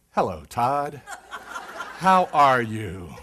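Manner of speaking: low, very slow greeting; match his slow pace, relaxed; slowed right down. Retold or imitated. Answering the slow client by matching his pace. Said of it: "leaned back in my chair, closed my eyes".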